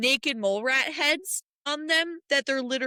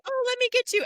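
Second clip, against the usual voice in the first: mock sweet Sarah voice; 13 semitones higher, 4 dB louder, faster than usual. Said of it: "She literally was like".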